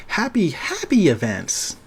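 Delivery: bright, high mock cheer; sarcastic. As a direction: fake cheerfulness, dripping sarcasm. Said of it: "I'm being extremely sarcastic".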